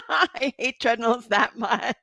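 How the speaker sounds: amused, slow laugh-talk. Real, not acted. Laughing about hating treadmills.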